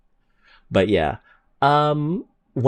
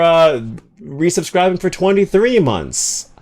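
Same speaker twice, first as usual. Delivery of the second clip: higher, louder gushing thanks; excited thanks; 7 semitones higher, 4 dB louder, slower than usual. Real, not acted. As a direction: thank them with a burst of joy. Thanking another resubscriber.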